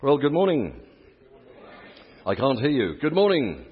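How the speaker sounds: loud, high call to the crowd; hearty preacher's good-morning shout; rousing greeting. Real, not acted. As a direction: call out for a bigger response.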